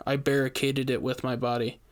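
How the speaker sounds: ordinary delivery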